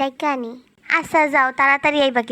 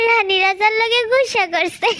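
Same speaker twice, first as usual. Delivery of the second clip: laughing; 7 semitones higher, 4 dB louder, slower than usual. Real, not acted. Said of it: "এত জোরে কেউ হাসে?".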